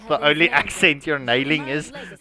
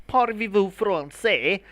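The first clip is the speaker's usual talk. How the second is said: bad French accent; 5 semitones higher than usual. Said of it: "my French accent just sucks".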